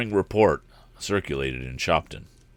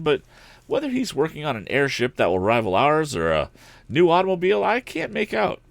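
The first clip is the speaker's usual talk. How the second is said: lightly voiced young man, higher and puzzled; higher young hero voice, unsure; puzzled young Tom; 7 semitones higher than usual. A narrator's reading.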